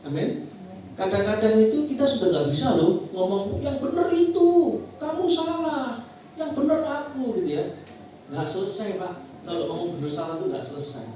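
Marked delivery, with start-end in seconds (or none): 3.6-7.3 high-pitched quarrelling voice; self-righteous arguing. Acted. Spouse arguing over who is right, high and insistent.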